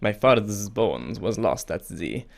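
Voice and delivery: slow, badly faked French accent slipping into Italian. Shown as a demonstration. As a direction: attempt a French accent and botch it. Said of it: "French accent or something".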